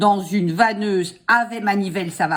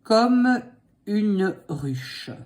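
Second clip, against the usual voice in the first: dictating; 3 semitones higher, much slower than usual. Shown as a demonstration. Dictating slowly.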